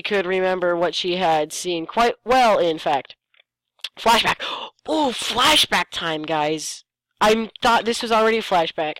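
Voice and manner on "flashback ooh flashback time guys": mock excitement. Real, not acted. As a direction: announce the flashback with mock glee. Announcing the flashback.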